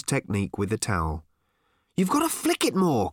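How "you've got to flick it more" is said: complaining. A narrator's reading. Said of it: "complained Ford".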